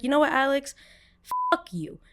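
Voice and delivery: high-pitched retold telling-off; defiant outburst. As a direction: tell him off, acting it out. Retold blow-up at Alex.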